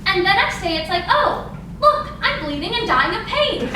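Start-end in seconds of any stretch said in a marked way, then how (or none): 1.1-3.7 mock surprise. Mock announcement of sudden period.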